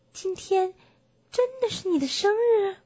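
high-pitched, doubting question; incredulous bear. Acted. Bear asks if it is really the donkey's birthday, incredulous. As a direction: check it in wide-eyed surprise, voice up.

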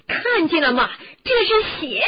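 loud, rising, accusing cry; distraught shout. Acted. Ron shows blood on sheet.

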